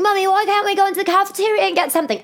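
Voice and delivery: put-on very high, fast child voice; whining daughter. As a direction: exaggerate a nagging little girl's plea. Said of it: "my daughter's like".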